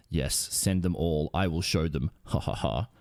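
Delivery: low, sinister villain voice with a mock laugh; a cartoon villain plotting murder, low; villainous Ackers. Ackers plotting to murder the rescuers, low and sinister. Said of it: "Ackers villain voice".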